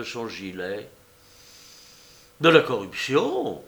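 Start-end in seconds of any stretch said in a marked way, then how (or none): 2.4-3.6 mock-outraged cry, raised in pitch and volume; feigned indignation. A narrator's reading. Man offered a tip for information, feigned indignation. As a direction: exclaim as if scandalised. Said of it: "s'écria Bengali en feignant d'indignation".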